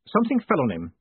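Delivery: short, dismissive; curt. A narrator's reading. Moist curtly brushes off Miss Dearheart's question. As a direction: shut the question down. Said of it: "said Moist shortly".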